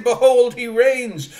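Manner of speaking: loud, high, ringing shout; triumphant proclamation. Acted. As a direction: proclaim it as a victory cry. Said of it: "It's saying".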